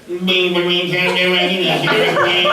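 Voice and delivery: Droopy Dog. Acted. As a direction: drone the order like a sad-sack cartoon hound. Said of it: "her voice kinda morphed into Droopy Dog".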